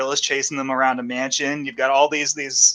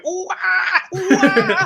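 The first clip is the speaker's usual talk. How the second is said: very high ape whoops; monkey hooting; much slower than usual. Acted. Monkey overheard from another apartment, hooting high. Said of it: "monkey that's in there going".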